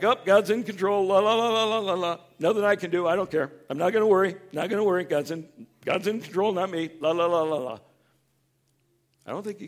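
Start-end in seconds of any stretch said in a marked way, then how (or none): 0.0-7.8 high, loud, carefree la-la mock; mock the shrugging believer; careless singsong. Mocking careless believers, loud singsong carefree. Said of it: "Sometimes we're like".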